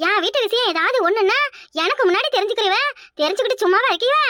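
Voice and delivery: normal talking voice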